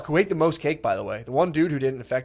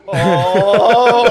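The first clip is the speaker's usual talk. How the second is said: high, loud drawn-out mock groan; loud silly oh; mock stoned groan; 7 semitones higher, 11 dB louder than usual. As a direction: ham up the friend's moaning. Imitating friend moaning on floor.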